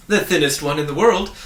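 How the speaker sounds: bright reply from the man at the door. A narrator's reading. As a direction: announce it brightly. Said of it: "he replied brightly".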